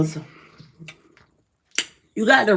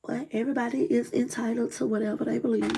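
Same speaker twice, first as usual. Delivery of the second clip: calm reply; 3 semitones higher, 8 dB quieter, faster than usual. Retold or imitated. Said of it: "I was like".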